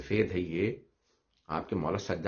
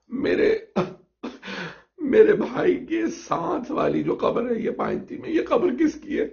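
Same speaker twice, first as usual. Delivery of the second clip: much higher, louder sobbing cry; wailing question; 5 dB louder than usual. Acted. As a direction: ask it through a rising wail. Zainab asks whose grave. (भाई के पास वाली कब्र के बारे में रोते हुए ऊँची आवाज़ में सवाल)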